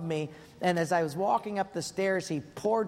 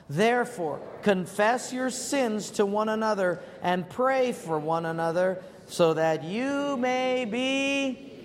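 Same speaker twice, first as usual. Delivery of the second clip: slow, solemn Bible reading; scripture reading; 4 semitones higher, slower than usual. Acted. Preacher reading James to the congregation, solemn. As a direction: read the verse slowly and weightily.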